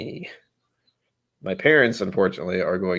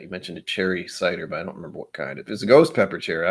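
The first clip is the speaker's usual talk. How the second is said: usual speaking voice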